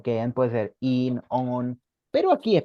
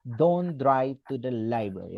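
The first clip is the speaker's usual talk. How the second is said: Don't drive to the library said slowly and distinctly; slow and clear, like dictation; teacher repeating the translation; slow model sentence; 2 semitones lower, slower than usual. Shown as a demonstration. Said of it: "No conduzca a la biblioteca".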